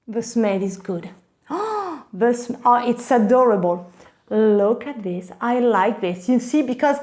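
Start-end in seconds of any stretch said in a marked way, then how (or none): 1.5-2.1 high, soft gasp of delight. Real, not acted. Unboxer admiring her new bag, delighted gasp.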